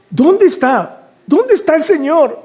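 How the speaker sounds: high, anxious pleading; weeping Mary. Retold or imitated. Mary turns to the gardener and pleads, tearful asking. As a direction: beg to know where he is. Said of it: "ella empieza a llorar".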